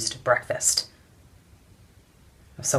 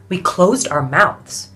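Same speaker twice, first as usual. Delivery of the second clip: impassioned protest; 2 semitones higher, 8 dB louder than usual. Acted. Suffragist recounts protest, building in outrage.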